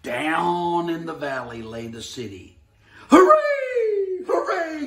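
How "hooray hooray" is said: very high, loud clown cheer; joyful cheer. Acted. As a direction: shout for joy at the top. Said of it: "cried the funny little clown".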